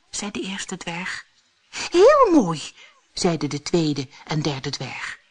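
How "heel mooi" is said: high, loud agreement; high-pitched admiring exclamation; admiring dwarfs. A narrator's reading. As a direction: chime in with delighted wonder.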